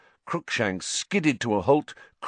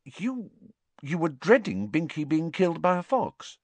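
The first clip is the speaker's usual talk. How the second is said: high, tentative question; careful, puzzled, pitch raised; hesitant; 8 semitones higher than usual. A narrator's reading. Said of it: "Hermione hesitated".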